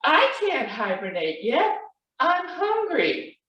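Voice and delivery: high cub voice, rising to a stretched last word; protesting Baby Bear. A narrator's reading. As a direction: complain like a hungry little cub.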